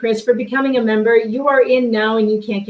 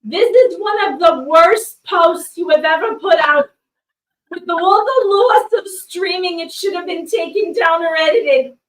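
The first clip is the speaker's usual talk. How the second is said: reading out Karen's complaint; 5 semitones higher, 5 dB louder than usual. Retold or imitated. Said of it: "This is what Karen said".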